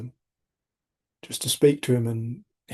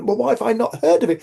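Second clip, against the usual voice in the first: higher, louder mock indignant surprise; 10 semitones higher, 5 dB louder than usual. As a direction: play up the disbelief. Acting his own surprised question.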